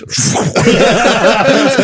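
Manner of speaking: short high cackling laugh. Real, not acted. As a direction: laugh at your own cheek. Laughs while retelling escape.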